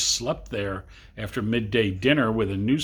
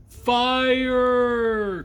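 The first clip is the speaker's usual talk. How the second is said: high, loud cry of alarm; panicked cry of fire; cried out; 13 semitones higher, 4 dB louder than usual. Acted. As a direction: shriek it in a panic. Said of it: "cried Missus Protherio".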